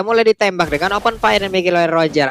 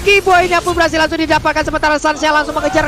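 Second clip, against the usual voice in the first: excited play-by-play; 6 semitones higher than usual. Real, not acted. Caster calls teamfight.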